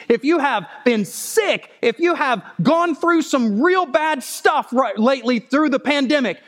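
high, loud, driving sermon climax; full-force preaching. Acted. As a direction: preach it out to the whole church.

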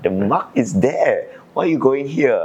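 retold frustrated protest, pitch climbing at the end. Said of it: "he got really frustrated".

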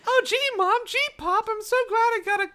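put-on high, loud gleeful kid; excited little boy. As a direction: squeal like a delighted child. Child voiced in story.

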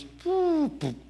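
high playful boo boo as it drops; cartoon falling-cracker sound; cracker falling noise. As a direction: make the cracker tumble with a high silly sound. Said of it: "and the cracker goes".

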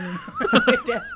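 squealing laugh through the words; breaking into high laughter. Real, not acted. Laughing while telling the story.

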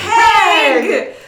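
delighted laughing shout; excited shout. Real, not acted. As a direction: shout the name with glee. Excited shout of character name.

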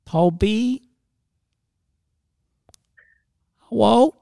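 high, slow silly cartoon voice; Elmer Fudd voice. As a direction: talk like a dim cartoon hunter. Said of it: "like, Elmer Fudd".

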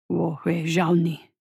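low, slow menacing voice; slow threat from the shadows; heavy voice. A narrator's reading. Hidden creature speaks Chinese.

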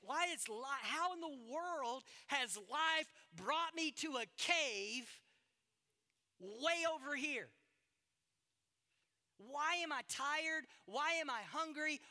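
impassioned sermon lament; anguished preaching. Acted. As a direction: cry out each why in despair. Preaching Elijah's despair loudly.

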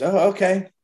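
a sudden caught-off-guard okay; startled girlfriend. Retold or imitated. Girlfriend startled, told to shoot.

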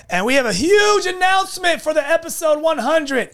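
excited. Pretend. Hyped podcast intro.

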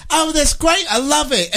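lightly voiced friendly British lady; British woman. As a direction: play the British woman asking where they're from. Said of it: "she was like".

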